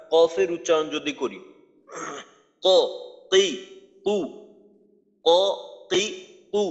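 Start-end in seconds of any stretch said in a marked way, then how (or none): 2.6-6.6 ক কি কু with a hard bouncing stop; qalqala letters. Shown as a demonstration. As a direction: strike ক from the throat, then release. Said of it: "এটা হলো কফের উচ্চারণ".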